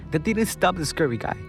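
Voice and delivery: normal talking voice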